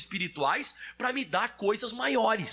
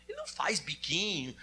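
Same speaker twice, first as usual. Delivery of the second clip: ordinary delivery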